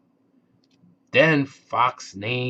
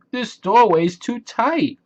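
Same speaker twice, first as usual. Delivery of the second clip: despairing cry; 9 semitones higher, 3 dB louder than usual. Acted. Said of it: "cried Moose".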